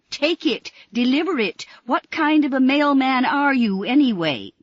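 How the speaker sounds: loud, high angry demands; furious. Acted. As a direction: scold him furiously.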